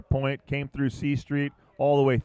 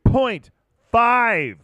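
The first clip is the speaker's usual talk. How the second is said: score call; 7 semitones higher, 8 dB louder than usual. Real, not acted. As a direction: stretch the score out with excitement. Announcing a score during live surf commentary.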